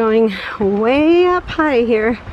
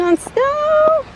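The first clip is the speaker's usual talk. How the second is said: excited. Real, not acted. Delighted squeal on snow.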